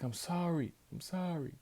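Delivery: high, softening apology; imitating Heyman saying sorry; mock apology. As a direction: play the conflicted manager apologizing. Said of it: "He's like".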